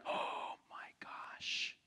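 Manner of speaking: slow, breathless whisper of awe; awed whisper. Retold or imitated. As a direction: hush it out in wonder. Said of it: "They're like".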